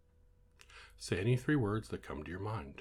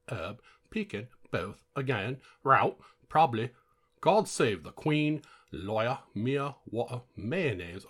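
shitty English accent; 4 semitones higher, 6 dB louder than usual. Shown as a demonstration. Trying the word list in other accents, hammed-up English accent. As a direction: overdo a bad English accent, pitched up. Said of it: "That was my shitty English accent".

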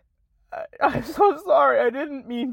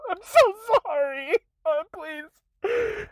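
crying apology; 7 semitones higher, slower than usual. Real, not acted. Creator sobbing an apology to followers, tearful. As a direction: voice cracking into tears, pitched up.

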